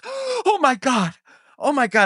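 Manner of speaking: very high, slow panicked gasps; panicked teacher. Retold or imitated. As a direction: imitate the teacher panicking at the blood. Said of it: "And she goes".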